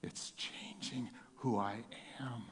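soft whispered confession. Real, not acted. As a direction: breathe it out like a prayer. Whispered prayer.

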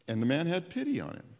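ordinary delivery

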